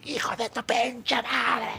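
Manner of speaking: high, strained imitation of a furious yell; angry yell. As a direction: act out his outraged outburst, high and drawn out. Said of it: "el primer grito que fue". (grito enojado imitado, agudo y estirado)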